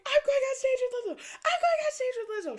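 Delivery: high, loud reenacted squeal; excited screaming. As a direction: squeal out the big news, very high. Screams the news to friend.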